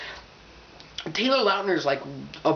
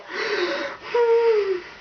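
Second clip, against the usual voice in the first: nervous high sigh. Retold or imitated. Guy caught, nervous.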